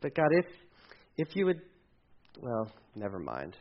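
halting, soft plea sinking into resignation; hesitant prayer. Acted. Believer unsure of asking God, faltering and giving up softly. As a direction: start to ask, falter, then drop low. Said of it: "If we have that".

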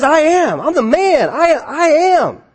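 high, drawn-out triumphant declaration; emphatic voice of God. Acted. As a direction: proclaim it as God, pitched well up. Preacher voices God declaring himself.

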